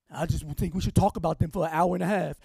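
mock gossip. Mocks gossiping group member.

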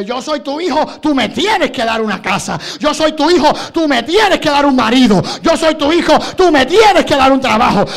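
put-on loud, insistent spoiled-kid demands; spoiled child demanding. Preacher mimicking believers who pray like spoiled children, loud demanding. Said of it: "pedimos como niños malcriados".

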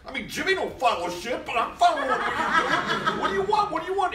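high, loud exasperated yelling; blowing up. Retold or imitated. Actor losing it at the director after repeated takes, loud exasperated yell. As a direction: explode at the director, hammed up. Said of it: "I, I, I blow".

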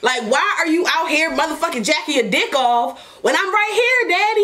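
rising outrage at Daddy; indignant complaint. Retold or imitated. Wife scolding Daddy for going solo, indignant and high.

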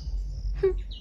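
brief breathy chuckle; soft laugh. Real, not acted. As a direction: let out a quiet little laugh. Amused aside while getting ready.

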